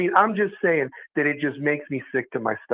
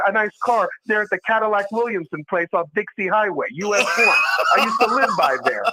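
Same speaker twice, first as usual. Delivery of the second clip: loud, higher ranting yell with laughter; shouting rant; 4 semitones higher, 6 dB louder than usual. Real, not acted. Host ranting to the stream about what recruits want, shouting. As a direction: blast it out over a laugh.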